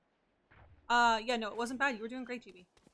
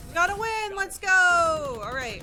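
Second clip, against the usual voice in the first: high, loud victory cheer; excited cheer; 9 semitones higher, 11 dB louder, slower than usual. Real, not acted. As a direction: celebrate the win out loud. Round won, celebrating.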